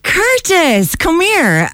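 high, bright call out to him; excited call. Real, not acted. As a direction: call his name with excitement. Host calls to Curtis.

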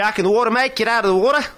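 loud, high mock Aussie yell; exaggerated high-pitched Australian accent. Shown as a demonstration. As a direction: shout a warning in broad Australian. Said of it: "[name] does a great Australian accent".

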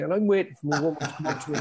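ordinary talk

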